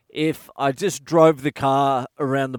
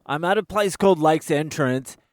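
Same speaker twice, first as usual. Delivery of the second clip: ordinary delivery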